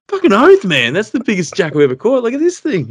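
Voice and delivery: high, thrilled exclamation, re-enacted; triumphant excitement. Angler to his mate about his biggest jack, triumphant excitement. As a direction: crow about your catch, voice leaping up.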